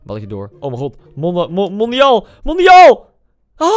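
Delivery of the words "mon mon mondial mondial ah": rising to a huge loud shout; building goal shout. Real, not acted. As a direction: build it up and roar the word.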